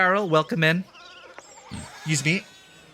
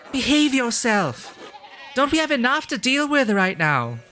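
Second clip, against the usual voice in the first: acted scolding; 8 semitones higher than usual. Game character telling someone to behave, scolding. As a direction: scold a troublemaker in a raised pitch.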